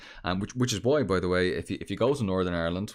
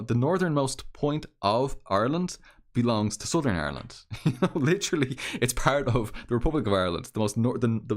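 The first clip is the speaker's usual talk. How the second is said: usual speaking voice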